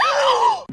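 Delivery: a sharp, very high startled gasp; frightened gasp. Real, not acted. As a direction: jolt in fright. Student frightened by reveal.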